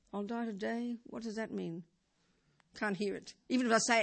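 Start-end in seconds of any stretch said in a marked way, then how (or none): 0.1-1.8 soft, baffled question to oneself; puzzled murmur. Retold or imitated. Teacher voicing the mind's puzzled reaction, softly baffled. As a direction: hear it as if from far away, confused.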